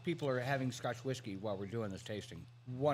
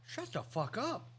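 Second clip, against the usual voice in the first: high, drawn-out astonished reaction; retold stunned response, pitched up; amazed disbelief; 6 semitones higher, 5 dB quieter, slower than usual. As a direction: stretch it out in disbelief. Retelling amazed reaction to first bite.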